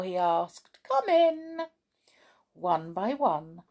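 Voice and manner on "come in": high sing-out invitation; storybook welcome, sung out high; calling out. A narrator's reading. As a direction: call them in, pitched way up. Moonface calls the children inside.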